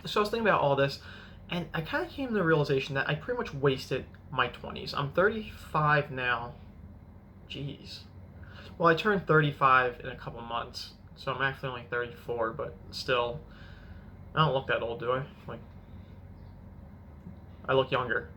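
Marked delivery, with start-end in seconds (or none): none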